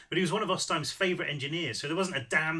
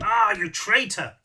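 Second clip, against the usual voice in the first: loud, high, drawn-out reproach; raised-voice indignation; outraged self-accusation; 5 semitones higher, 9 dB louder, slower than usual. A narrator's reading. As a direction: accuse your own brain with exasperated force. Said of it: "she whispered to her brain".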